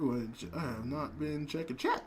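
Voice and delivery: low, soft mumble; low mumbled aside. Retold or imitated. Streamer realizing chat was missed, quiet low aside. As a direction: say it under your breath, low. Quoting own muttered realization.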